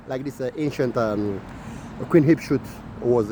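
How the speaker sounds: usual speaking voice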